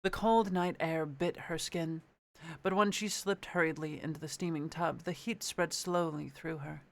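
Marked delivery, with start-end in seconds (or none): none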